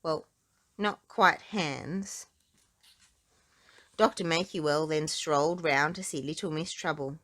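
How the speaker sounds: ordinary delivery, nothing marked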